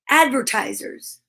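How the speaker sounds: normal talking voice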